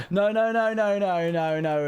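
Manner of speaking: high, loud rush of no's, easing down; frantic protest. Real, not acted. Streamer catching own slip, frantic protest. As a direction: burst out high, then settle.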